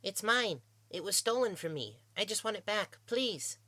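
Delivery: high, urgent little plea; desperate pleading. A narrator's reading. Young slave pleads for stolen journal.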